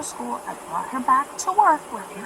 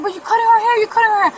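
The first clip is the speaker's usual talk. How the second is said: high, louder mock-outraged protest; mocking the neighbor; 10 semitones higher, 6 dB louder, much faster than usual. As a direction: parrot her indignant voice. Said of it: "And she said".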